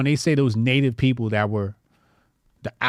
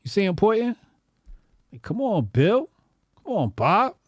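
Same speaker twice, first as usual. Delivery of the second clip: higher, mock exasperated brother; voiced painting figure; 8 semitones higher than usual. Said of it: "He like".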